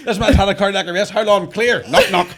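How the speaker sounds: high, loud laughing emergency voice; loud laughing pretend resuscitation; mock paramedic drama. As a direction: ham up a frantic paramedic.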